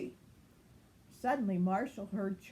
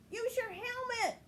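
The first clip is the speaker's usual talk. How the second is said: much higher urgent cry. A narrator's reading. Ryder calling as Fuzzy falls, much higher cry. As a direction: shout the tip fast. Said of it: "Ryder cried".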